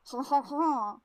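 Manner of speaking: mock French chuckle; hammed-up French ha-ha; French laugh. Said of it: "sorry, they're French".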